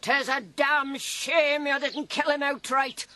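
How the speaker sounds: ordinary talk, nothing marked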